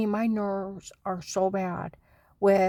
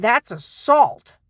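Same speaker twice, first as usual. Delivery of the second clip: slow, much louder, firm; deputy's firm verdict; 4 semitones higher, 10 dB louder, much slower than usual. Retold or imitated. Deputy telling the wife it was assault, slow and loud. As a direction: lay it down like an officer. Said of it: "She said".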